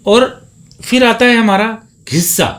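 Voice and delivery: ordinary delivery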